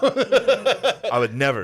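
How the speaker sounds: slow, drawn-out, high protest; mock innocent. Shown as a demonstration. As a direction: overplay the innocence. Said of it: "joking denial of Kamala joke".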